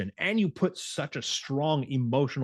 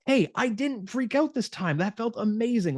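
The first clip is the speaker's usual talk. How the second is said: much higher, thrilled announcement; excited bragging; 10 semitones higher than usual. Retold or imitated. Client telling a friend about her win, thrilled and much higher. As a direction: share the win like it's your birthday. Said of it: "tell anybody".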